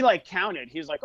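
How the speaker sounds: ordinary talk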